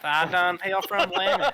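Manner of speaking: folksy Southern yokel introducing himself; southern accent. Acted. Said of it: "speaking in a southern accent".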